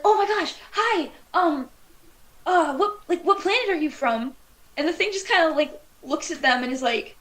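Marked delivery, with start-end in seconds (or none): 0.0-4.4 thrilled fan meeting an alien; excited greeting. Acted. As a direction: fumble eagerly for words in a raised pitch. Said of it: "just as fucking excited to see this thing".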